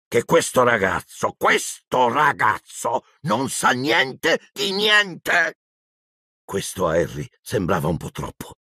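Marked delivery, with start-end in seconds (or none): 0.1-5.6 slow, rising angry disbelief; Hagrid, growling outrage. A narrator's reading. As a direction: build from a growl to a high shout. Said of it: "gli ringhiò in faccia".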